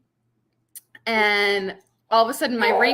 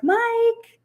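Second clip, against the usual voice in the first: very high, louder imitated call; old lady neighbor; 5 dB louder than usual. Old neighbor mistakes the appraiser, high imitated call. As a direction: call out as the elderly neighbor, pitched way up. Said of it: "she doesn't see very well".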